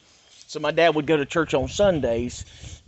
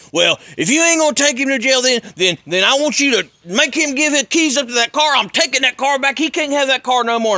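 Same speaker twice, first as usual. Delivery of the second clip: high, louder whiny father voice; mocking his dad; 12 semitones higher, 7 dB louder than usual. Dad demands the car back.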